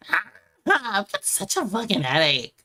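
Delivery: loud, pained complaint; pained groan. Acted. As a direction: groan through a headache. Drunk character in pain.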